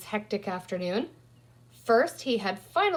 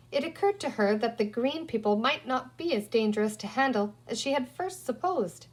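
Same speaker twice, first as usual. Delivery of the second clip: usual speaking voice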